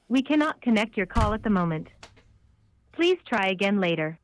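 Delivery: ordinary talk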